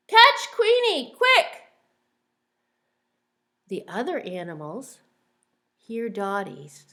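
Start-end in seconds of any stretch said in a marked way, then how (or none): 0.1-1.6 high, loud, slow quacking shout; loud duck shout. Acted. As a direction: sound the alarm like a loud duck. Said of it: "quacks Dottie".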